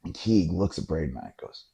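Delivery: ordinary talk, nothing marked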